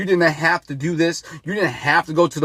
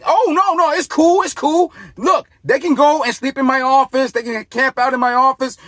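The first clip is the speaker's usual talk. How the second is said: high, sing-song mock of eager politicians; 6 semitones higher than usual. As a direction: sneer it out in a much higher fake-nice voice.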